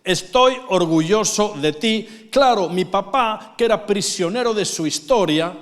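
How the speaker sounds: preaching shout. Real, not acted. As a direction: proclaim it with full force. Preaching climax.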